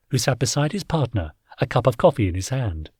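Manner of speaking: plain reading voice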